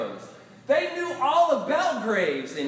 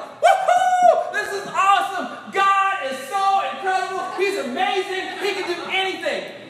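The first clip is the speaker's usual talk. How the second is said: much higher, louder joyous cheer; ecstatic; 8 semitones higher, 6 dB louder than usual. Retold or imitated. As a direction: celebrate good news wildly. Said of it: "I'm like".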